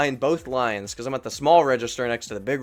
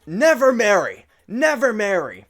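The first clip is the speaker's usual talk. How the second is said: loud, high-pitched mock warning; imitated customer yelling; 10 semitones higher, 8 dB louder than usual. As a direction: holler it as the worked-up customer. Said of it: "he's actually yelling at me".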